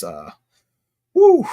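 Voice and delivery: ordinary talk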